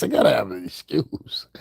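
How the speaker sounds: playful put-on plea; mock whiny voice. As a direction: whine it like a fake victim. Repeats line in mock whiny voice.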